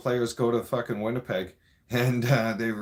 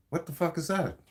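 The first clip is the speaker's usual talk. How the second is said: imitating Trump; 6 semitones higher than usual. Voicing Trump's reaction at dinner.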